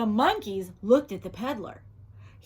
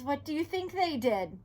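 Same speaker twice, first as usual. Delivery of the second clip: usual speaking voice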